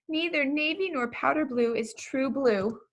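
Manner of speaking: ordinary delivery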